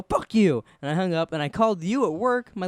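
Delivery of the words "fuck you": high, loud mock fury; retold angry outburst. Boy rebuffs his joking friend by phone, retold anger. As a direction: throw it back, louder and higher. Acted angry reply before hanging up.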